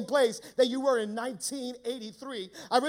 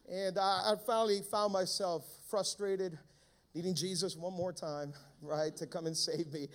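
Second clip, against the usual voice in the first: ordinary talk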